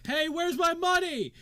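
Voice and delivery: high, loud, drawn-out crowd yell; rowdy shout. Acted. Angry bettor to the betting table, shouting. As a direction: holler it over a noisy crowd.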